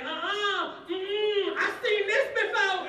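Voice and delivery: very high, loud refusal; alarmed audience couple. Acted. Couple getting up to leave, high loud refusal.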